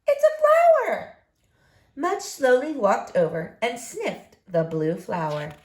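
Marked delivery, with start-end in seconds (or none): 0.0-1.2 high, loud cry of discovery; excited call. Acted. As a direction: call out thrilled at the find. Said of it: "he called".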